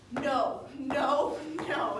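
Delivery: loud mock wail, each "no" belted out; imitated screaming refusal. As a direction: act out a melodramatic screamed refusal. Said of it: "She's screaming".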